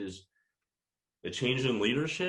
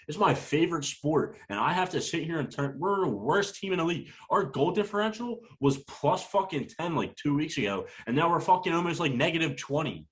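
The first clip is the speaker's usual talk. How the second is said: heated rant; 7 semitones higher than usual. Real, not acted. Fan ranting to his co-host about his team, heated and high. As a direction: voice climbing higher, exasperated and ranting. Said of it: "Rant over".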